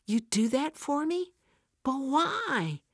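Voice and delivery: can't believe the kindness; bewildered. Acted. Joyce to Fran, offered church help: high bewildered disbelief. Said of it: "looks at Fran in bewilderment".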